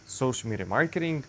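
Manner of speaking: usual speaking voice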